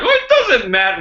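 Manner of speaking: mock the people who shrug it off; mocking quote. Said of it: "some people are like".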